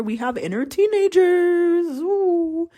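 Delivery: very high, drawn-out singsong marvel; playful amazement. Pretend. Host telling listeners of a discovery, high playful amazement. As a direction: stretch it up high in mock wonder.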